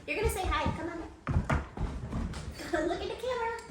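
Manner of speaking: high coaxing voice to the dog. Real, not acted. Talking to the dog.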